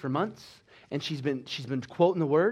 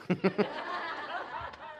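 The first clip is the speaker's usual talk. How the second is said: high laugh; 11 semitones higher, 7 dB quieter than usual. Real, not acted. Preacher laughing at her own story, high laugh.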